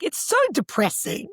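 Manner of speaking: put-on high-pitched kid complaint; complaining child. Nine-year-old criticising mum's podcast, high complaining voice. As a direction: imitate a child's high, blunt verdict.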